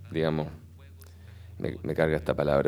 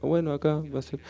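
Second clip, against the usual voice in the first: lighter, higher voice for his own thought; quick mock inner voice, higher pitch; quoting own thought; 10 semitones higher, faster than usual. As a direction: toss off the realisation in a raised inner voice. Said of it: "como cachar".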